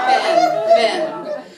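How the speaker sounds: high, loud sing-song call; a loud, high, drawn-out call of the name. Acted. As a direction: call Ben from across the room. Calling out a man's name longingly.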